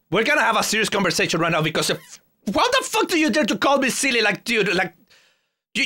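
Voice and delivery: high-pitched, rushed indignant rant; mock outrage.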